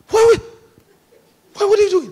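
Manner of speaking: high, loud cry of disbelief; alarmed protest. Acted. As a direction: squeal it out as a shocked angel. Angels react in alarm to rebellion.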